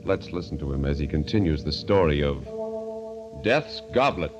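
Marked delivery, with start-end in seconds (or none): none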